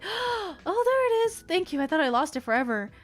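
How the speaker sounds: relieved gasp. Retold or imitated.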